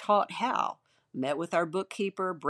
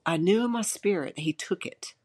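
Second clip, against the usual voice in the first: ordinary delivery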